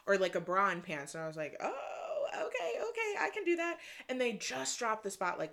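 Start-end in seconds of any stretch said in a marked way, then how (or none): 1.6-3.8 high, squeaky reenacted okay; delighted agreement. Delighted at the outfit suggestion.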